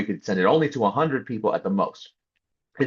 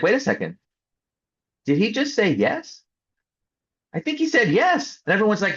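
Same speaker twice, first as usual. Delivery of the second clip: much higher, disbelieving "did he just say yes"; amazed realization; 11 semitones higher than usual. Retold or imitated. Team members realizing the lawyer said yes, stunned and high.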